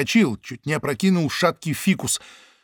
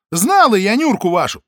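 loud retort; 7 semitones higher, 7 dB louder than usual. Acted. Паша громко.

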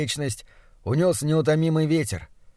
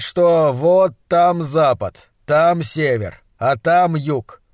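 louder, emphatic doctor voice; self-satisfied monstrologist; 4 dB louder than usual. Acted. Doctor pointing out the sunrise to his assistant, self-satisfied.

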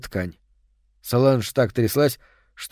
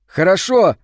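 higher and louder, gladly agreeing; cheerful agreement; 6 semitones higher, 5 dB louder than usual. A narrator's reading. Boy gladly agrees to go outside with the girl, higher and louder. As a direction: say yes with delight. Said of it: "весело согласился он".

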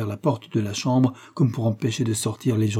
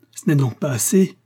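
higher, louder protest; indignant cry; 4 semitones higher, 5 dB louder than usual. Acted. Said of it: "s'écria le chevalier".

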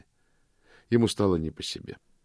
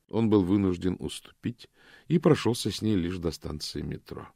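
plain reading voice

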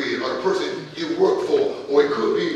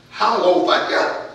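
ordinary delivery

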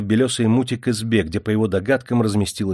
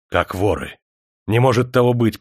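disbelief building to a louder snap; sharp rebuke; 4 dB louder than usual. A narrator's reading. Major refuses to believe the sergeant's alarm, snapping. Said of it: "прикрикнул майор".

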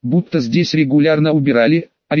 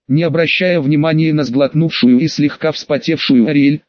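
ordinary delivery

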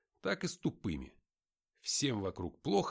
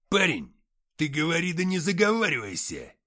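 loud, high furious roar; 4 semitones higher, 9 dB louder than usual. Acted. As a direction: warn him off with a bellow. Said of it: "взревел Антоха".

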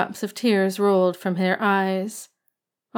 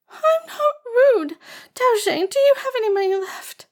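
tearful protest. A narrator's reading. Upset blind girl protests.

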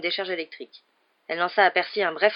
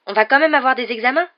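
much louder, higher protesting cry; outraged exclaim; 6 semitones higher, 10 dB louder than usual. A narrator's reading. Seamus protesting to McGonagall, loud outrage. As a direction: shout the disbelief at the teacher. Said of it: "s'exclama Seamus Finnegan".

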